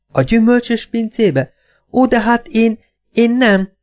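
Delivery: high, faltering protest; high-pitched disbelieving mother; dismayed mother. A narrator's reading. Mother dismayed, voiced in story.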